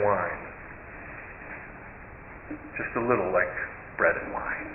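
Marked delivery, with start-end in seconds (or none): 2.8-4.7 softer, breathy whisper trailing away; hushed whisper. Shown as a demonstration. Storyteller repeats the taste of the soup to the congregation, whispered. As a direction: let it sink in, almost unvoiced.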